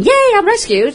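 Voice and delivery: very high, gleeful squeal for the dog; mock jubilant cheer. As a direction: celebrate as an overjoyed rescued dog. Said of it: "they're not initially going".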